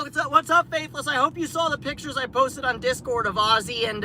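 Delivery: ordinary talk, nothing marked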